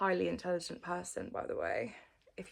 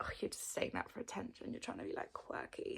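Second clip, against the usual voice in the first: mock sceptics; 9 dB quieter, faster than usual. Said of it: "people are like".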